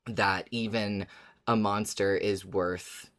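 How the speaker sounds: ordinary talk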